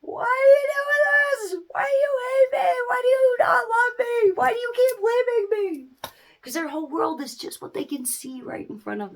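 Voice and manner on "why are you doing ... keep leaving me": put-on high, loud wailing baby pleading; high crying baby voice. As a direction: whine like a sobbing infant. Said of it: "what that baby is saying".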